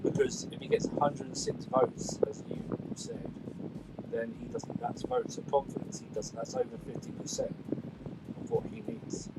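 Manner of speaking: faint, windy, distant voice; far from mic. Real, not acted. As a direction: talk from well away from the mic. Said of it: "it sounds like he's far away".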